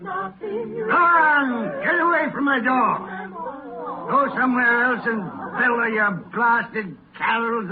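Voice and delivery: high, loud shout at the door; angry shout. Acted. Scrooge shouting carolers away from his door. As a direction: bellow them away from the door.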